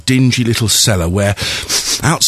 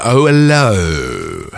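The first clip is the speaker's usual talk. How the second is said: very slow, lower hello; drawn-out low hello; 5 semitones lower, much slower than usual. Shown as a demonstration. Mic check, trying voices.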